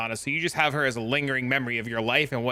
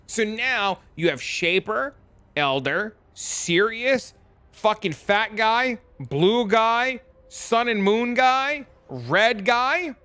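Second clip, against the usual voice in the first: exasperated list; 6 semitones higher, much slower than usual. Real, not acted. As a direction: pile on each boss name with rising disbelief, slowly. Exasperated list of new bosses.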